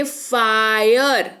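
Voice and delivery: "फायर" stretched slowly on its long आय; drawn-out आय sound. Shown as a demonstration. Teacher lets learners hear आय in fire, drawn-out demo. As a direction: draw out "फायर" so the आय glide is heard. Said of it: "सुनाई दे रहा है?".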